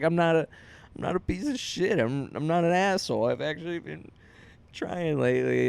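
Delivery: high, strained, half-tearful protest; man retelling a note on his car, mock tearful; retold crying. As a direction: plead through tears after a nasty note. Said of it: "and I start crying".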